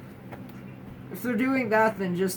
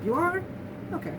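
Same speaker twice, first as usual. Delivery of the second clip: a brief high question, then drop it; casual acceptance; 8 semitones higher, 4 dB quieter, faster than usual. Retold or imitated. Someone hearing the news in the best response, casual acceptance. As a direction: imitate mild surprise without any fuss.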